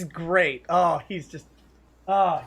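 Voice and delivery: ordinary delivery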